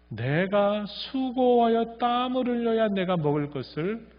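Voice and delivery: voicing God. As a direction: quote God's words with a hint of gravity. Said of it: "이렇게 또 하나님 말씀하시거든요". (하나님 말씀을 살짝 흉내 내어)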